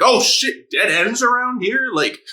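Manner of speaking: imitating a guy who just flipped out; mock freak-out. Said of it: "he just flipped".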